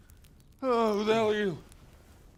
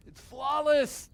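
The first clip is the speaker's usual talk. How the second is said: smug; 9 semitones higher, 6 dB louder, much slower than usual. Acted. Boasting about his plan.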